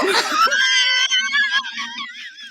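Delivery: a sudden hoot of laughter at the joke; burst of laughter. Real, not acted. Big shared laugh at mom.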